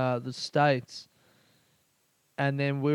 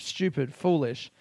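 ordinary talk, nothing marked

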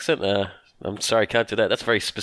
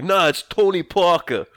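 put-on slow, higher New York accent; Tony Danza; 6 semitones higher, 4 dB louder, slower than usual. Actor demanding his own name for the movie, stubborn New York accent. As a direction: insist on it in broad New York tones. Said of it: "a specific thing he wants to do".

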